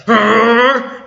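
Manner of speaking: a high, loud mock growl; playful growl of a tough woman; growling pirate arr. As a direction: snarl it like a fierce fighter. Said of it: "She's like".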